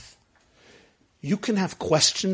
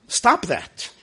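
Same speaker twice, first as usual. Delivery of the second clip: ordinary talk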